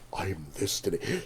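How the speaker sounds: low, soft mock customer; customer impression. Showing what the customer did, hushed mock impression. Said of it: "like, when he did this".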